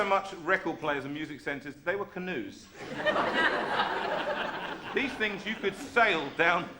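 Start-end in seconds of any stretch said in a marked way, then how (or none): 2.7-4.9 a burst of high airy laughter. Real, not acted. Laughing at his own canoe joke, high airy laugh. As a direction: laugh out loud at the memory.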